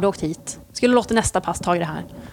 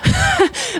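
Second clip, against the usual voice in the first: high burst of laughter; laughing; 4 dB louder than usual. Real, not acted. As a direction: laugh at your own confession. Laughs at own confessed thought. (högt, spontant skratt)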